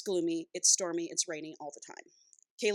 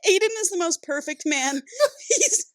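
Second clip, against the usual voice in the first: high, helpless laughing fit; crying with laughter; 12 semitones higher, much slower than usual. Real, not acted. Reader sharing her gushing book review, crying with laughter. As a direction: lose it mid-sentence, squeaking through real laughter. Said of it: "like, crying. I'm laughing so hard".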